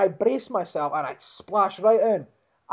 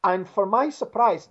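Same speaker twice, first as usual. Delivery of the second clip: ordinary talk, nothing marked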